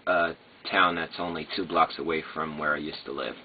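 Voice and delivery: ordinary talk, nothing marked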